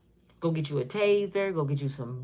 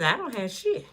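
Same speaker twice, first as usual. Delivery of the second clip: ordinary talk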